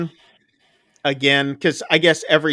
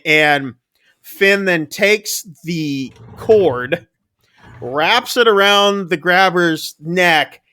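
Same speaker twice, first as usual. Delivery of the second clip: ordinary talk, nothing marked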